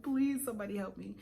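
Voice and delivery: ordinary talk, nothing marked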